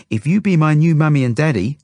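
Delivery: put-on high, loud little-girl voice; Zelda. A narrator's reading. Zelda bargains with the couple, high loud child voice. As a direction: pipe up eagerly like a small child.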